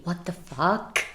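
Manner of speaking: normal talking voice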